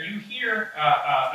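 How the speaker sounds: ordinary talk, nothing marked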